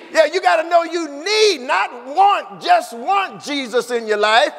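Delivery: ordinary delivery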